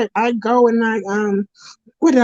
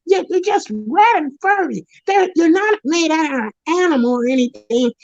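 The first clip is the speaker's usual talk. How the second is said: normal talking voice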